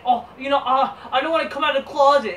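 laughing mock impression of a wrestler. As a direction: ham up a coy mocking whine. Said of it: "it's like".

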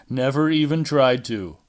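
ordinary delivery

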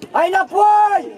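high, slow, drawn-out yell; long shout. Real, not acted. Coach ordering players to get back, long shout. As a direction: holler it with stretched vowels.

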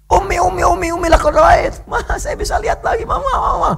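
high, urgent cries for mother; excited calling out. Retold or imitated. Young Bukhari shouting to his mother that he can see, high excited. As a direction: call out high and overjoyed.

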